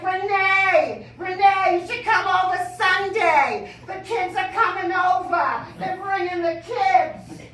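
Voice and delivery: very high, shouted East Boston mom calling her friend; mother on phone, loud East Boston. Retold or imitated. Mother inviting her girlfriend Renee over Sunday, in a high, loud East Boston holler. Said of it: "my mother talks like this on the phone".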